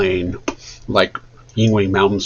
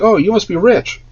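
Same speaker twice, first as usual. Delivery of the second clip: friendly greeting; 10 semitones higher than usual. Retold or imitated. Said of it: "he's like".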